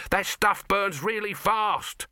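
high, slow, emphatic shout; high-pitched exasperated warning. A narrator's reading. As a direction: shout a warning, drawing out each word. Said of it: "shouted Dibbler".

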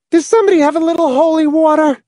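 high, fast call across the room; penetrating soprano. Acted. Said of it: "called in a penetrating soprano".

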